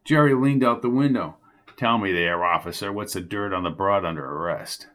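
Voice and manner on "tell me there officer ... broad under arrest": lightly voiced old reporter, lower; a hint of a sneering old newsman; lightly voiced old reporter taunting Jones. A narrator's reading. Jerry quizzes officer.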